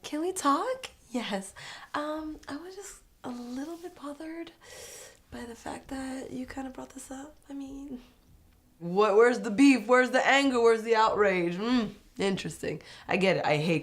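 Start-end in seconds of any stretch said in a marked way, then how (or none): 0.0-8.0 high, hushed, meek little voice; whispery, hesitant, high-pitched mimicry; mock timid confrontation. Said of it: "I don't like confrontation". 8.8-11.6 louder, punchy repeated questions; hammed-up, raised-voice challenge; mock demanding. Mock demand after meek confrontation.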